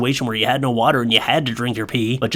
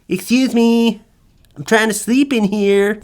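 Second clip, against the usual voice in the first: put-on much higher, put-out baby voice; annoyed baby; 9 semitones higher, slower than usual. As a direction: complain like a disturbed baby. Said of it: "Just like".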